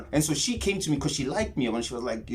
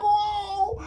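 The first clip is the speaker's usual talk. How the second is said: much higher, drawn-out rising whoa; awestruck whoa. Retold or imitated. Hearing the voices for the first time, high awed whoa. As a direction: gasp it in wonder.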